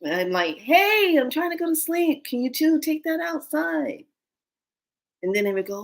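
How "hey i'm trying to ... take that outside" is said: high-pitched call to noisy roommates; pleading, high-voiced telling-off; mock complaint. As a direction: call out in playful exasperation, pitched up. Complaining to whispering ghosts at night.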